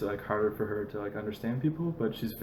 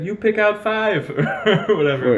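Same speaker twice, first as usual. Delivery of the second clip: laughing imitation of the seller, louder; laughing quote; 9 semitones higher, 9 dB louder than usual. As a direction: imitate her while laughing. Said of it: "She's like".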